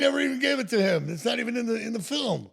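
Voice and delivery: high, loud astonishment about the missing birds; excited disbelief. Real, not acted. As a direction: tell it like a revelation.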